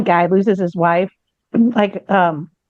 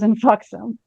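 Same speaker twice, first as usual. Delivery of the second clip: usual speaking voice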